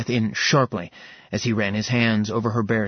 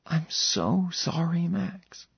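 higher, slow and soft apology; Serafina, tender sorry; 7 semitones higher, 5 dB quieter, slower than usual. Acted. Serafina apologizing to Max, slow and soft. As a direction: ache through a slow gentle sorry.

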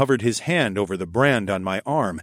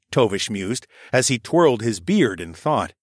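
plain reading voice

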